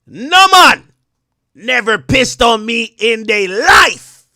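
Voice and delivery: put-on loud, high, slow Jamaican accent. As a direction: belt it out slowly in a mock Jamaican accent. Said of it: "he got a Jamaican accent".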